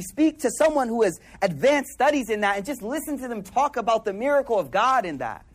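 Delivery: usual speaking voice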